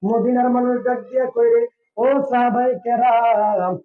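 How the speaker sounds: high-pitched chanted sermon narration; melodic preaching. A narrator's reading. Preacher telling of Medina's people pleading, high chanted preaching. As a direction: keep it high and ringing, drawing out the last call.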